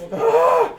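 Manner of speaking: high sudden frustrated ah; a flash of rage in one sound; mock angry cry; angry burst. Hot anger burst.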